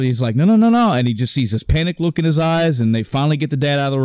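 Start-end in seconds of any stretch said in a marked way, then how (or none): 0.4-0.9 a high, loud retold shout of no; dad's desperate shout. Retelling the dad refusing to leave his son, loud desperate shout. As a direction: shout no like a father who won't leave.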